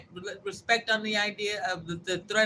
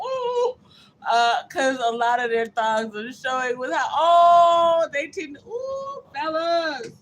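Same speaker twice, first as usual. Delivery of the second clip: mock wailing read; 6 semitones higher, 7 dB louder, slower than usual. Reading out a dramatic comment.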